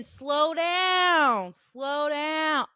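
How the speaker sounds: long, stretched-out call, easing on the repeat; retold yell. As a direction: imitate a man hollering after someone. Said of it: "He yells again".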